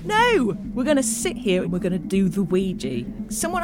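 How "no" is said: insistent. Acted. Refusing to give up.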